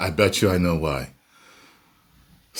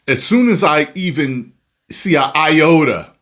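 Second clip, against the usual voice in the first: emphatic; 8 semitones higher, 6 dB louder than usual. Real, not acted. Emphatic rant about banning negative comments.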